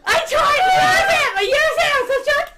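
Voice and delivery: high, loud breathless yelling; excited shout. Acted. Telling her friend she tried to text, shouting high and loud. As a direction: yell it, still buzzing. Excited exchange after news.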